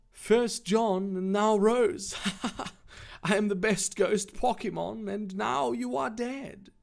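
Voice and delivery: hammed-up sultry villain; Giratina. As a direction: gloat seductively, with a laugh. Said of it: "stick with the sexy voice".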